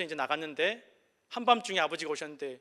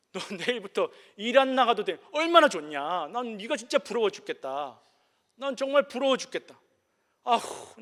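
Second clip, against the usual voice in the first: loud, higher-pitched gushing envy; 6 semitones higher, 5 dB louder than usual. Acted. Father to his just-fired friend, loudly and sincerely envious. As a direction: act out a tactless friend marvelling in envy. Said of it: "정말 부러워서 하는 얘기를 하는 거예요".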